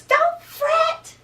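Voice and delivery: high, loud little mouse voice; high squeak easing into eager reassurance; squeaky mouse. A narrator's reading. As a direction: squeak it out to comfort a friend. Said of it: "Mouse squeaks".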